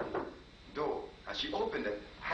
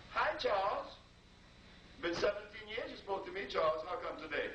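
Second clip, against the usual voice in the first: higher, pointed woman's voice; voicing the wife; 8 semitones higher than usual. Wife reproaches her husband for his long silence, in a higher pointed voice. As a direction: needle him for seventeen years of silence.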